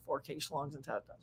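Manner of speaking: quiet, disbelieving repeat of the phrase; incredulous echo. Retold or imitated. Echoing Ken's line back in quiet disbelief. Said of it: "I'm like".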